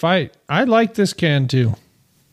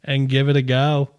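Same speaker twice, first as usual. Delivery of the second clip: jokey Aussie sign-off; best Australian accent. Acted. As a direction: finish the read in a put-on Aussie twang. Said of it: "Best Australian accent?".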